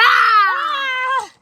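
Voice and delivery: high playful fake scream; mock scared shriek. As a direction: squeal in pretend fright. Pretends to be scared of monster.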